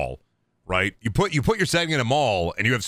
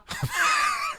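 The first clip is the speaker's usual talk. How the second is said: high-pitched squealing laugh; 3 dB quieter than usual. Real, not acted. Streamer cackles at own joke.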